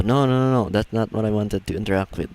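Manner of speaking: usual speaking voice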